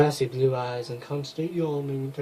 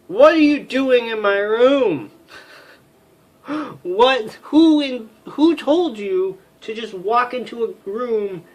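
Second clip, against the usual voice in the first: furious outburst; 6 semitones higher than usual. Acted. Outraged officer yells at the intruder in her room. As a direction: tear into the intruder.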